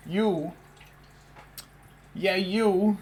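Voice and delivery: high, loud cooing pet voice. Real, not acted. Streamer greets her attention-seeking cat in loud, high baby talk. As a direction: coo and gush over the needy cat.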